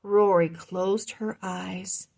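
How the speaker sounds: plain reading voice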